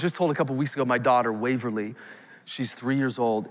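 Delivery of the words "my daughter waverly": normal talking voice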